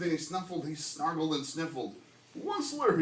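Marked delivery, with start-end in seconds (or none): 2.4-3.0 croaking cry. A narrator's reading. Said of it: "he cried with a cruffless croak".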